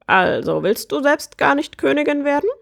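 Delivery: high bird voice, rising; puzzled bird prince. Acted. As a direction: ask it high as a baffled bird.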